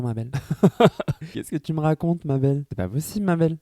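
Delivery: high, laughing mock scold; teasing friend voice. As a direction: tease like a sassy friend. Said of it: "elle était en mode".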